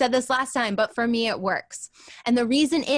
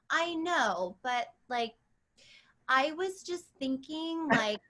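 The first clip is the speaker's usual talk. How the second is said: put-on a light sketch of a dreamy rich girl; imitating Alexis; 2 semitones higher, 6 dB quieter, slower than usual. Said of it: "Alexis from Schitt's Creek".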